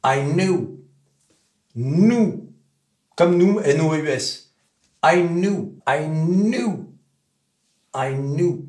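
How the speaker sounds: knew in an American accent, said like French nous; American knew, rhymes with nous. Shown as a demonstration. Teacher showing the American way to say knew. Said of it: "Les Américains, en revanche, ils vont dire".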